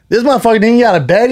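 high, quick mocking jab; clowning. Comic friends ribbing him over a bed video, clowning. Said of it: "his boys who are comics are clown like".

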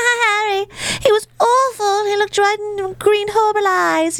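put-on very high, whiny ghost girl. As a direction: croon it like a lovesick ghost. [name] impression continues.

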